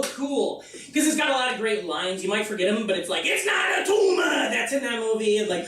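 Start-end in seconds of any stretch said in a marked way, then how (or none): 3.2-4.5 Arnold Schwarzenegger. Retold or imitated. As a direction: bark the movie line in a mock Austrian accent. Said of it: "say it like Arnold Schwarzenegger".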